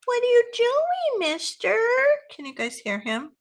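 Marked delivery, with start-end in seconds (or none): 0.1-1.8 squeaky high pet voice to the cat. Real, not acted. Crafter cooing to her cat in a high pet voice.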